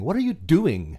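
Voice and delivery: put-on Scottish, rising to a loud, high indignant question; playful Scots brogue, building to mock outrage; Scottish accent. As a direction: imitate an affronted Scot. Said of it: "hate mail from Scots saying".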